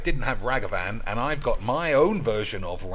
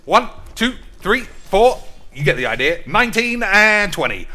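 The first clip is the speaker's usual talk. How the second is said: loud, high counting through push-ups; effortful counting; 6 semitones higher, 6 dB louder than usual. Acted. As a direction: bark out each rep loud and high.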